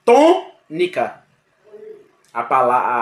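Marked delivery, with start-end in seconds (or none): 0.1-1.2 "Tônica" drawn out slowly and higher, stressing TÔ; slow syllable by syllable. Shown as a demonstration. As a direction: sound out "Tônica" slowly, weight on TÔ. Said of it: "A palavra tônica também". ("Tônica" devagar e mais agudo, força no TÔ)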